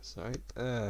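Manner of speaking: hiccuping between words; hiccups. Real, not acted. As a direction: talk through a hiccup. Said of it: "I've got the hiccups".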